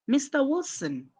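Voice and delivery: "Mister Wilson" lifted high to address someone; rising intonation. Shown as a demonstration. Teacher shows how to address someone, rising intonation. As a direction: raise the pitch on "Mister Wilson". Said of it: "your intonation is rising".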